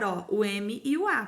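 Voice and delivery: ordinary talk, nothing marked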